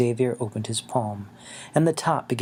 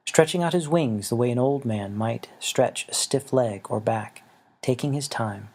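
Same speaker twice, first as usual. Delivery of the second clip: ordinary delivery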